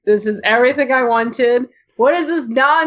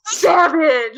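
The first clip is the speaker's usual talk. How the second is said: gleeful shout; 5 semitones higher than usual. Real, not acted. Cheering a roast.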